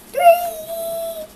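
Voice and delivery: very high, loud squeal of dismay; shocked squeal. Acted. Elephant shrieking as he counts three eggs on his head.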